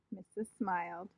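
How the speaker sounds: ordinary delivery, nothing marked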